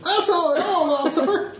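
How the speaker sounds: overenthusiastic witness. Acted. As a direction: gush at the cop like a thrilled witness. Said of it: "be the overenthusiastic witness".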